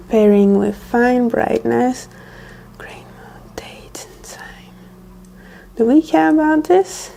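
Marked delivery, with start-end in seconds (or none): 2.8-4.7 whispering. Real, not acted. Whispered reading of menu.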